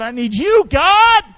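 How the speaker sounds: rising to a loud, high cry; crying out to God. Acted. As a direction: call up to God with full force.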